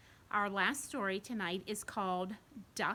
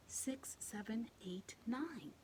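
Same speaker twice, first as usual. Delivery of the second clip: hushed counting; 2 semitones higher, 9 dB quieter, slower than usual. Real, not acted. Counting softly.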